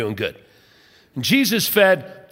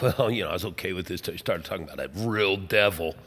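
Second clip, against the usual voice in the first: skeptical listener; 12 semitones lower, 8 dB quieter, faster than usual. Retold or imitated. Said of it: "Some of you are like".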